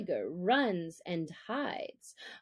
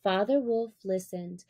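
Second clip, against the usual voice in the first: plain reading voice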